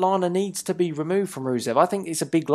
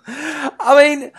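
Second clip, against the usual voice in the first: words squeezed out through a high laugh; real giggle breaking through; 11 semitones higher, 6 dB louder than usual. Real, not acted. Host laughing at his cohost's bobsleigh mixup, much higher. Cracks up at Cool Runnings joke.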